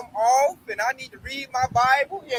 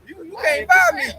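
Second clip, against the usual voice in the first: indignant protest building to a high, loud finish; offended, rising into a loud outburst; retold offense; 5 semitones higher, 3 dB louder than usual. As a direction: push back, climbing higher on the refusal. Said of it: "So I'm getting offended".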